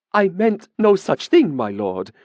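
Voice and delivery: a touch of real fear; a woman backing away from the mage in supplication; frightened. A narrator's reading. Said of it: "frightened expression was not completely feigned".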